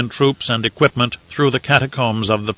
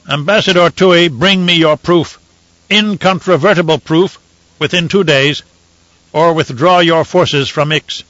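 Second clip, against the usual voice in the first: commanding; 5 semitones higher, 4 dB louder than usual. Acted. Emperor commanding the Tleilaxu envoy, stern. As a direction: lay down the ultimatum. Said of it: "Elrude commanded".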